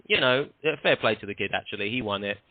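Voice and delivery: ordinary talk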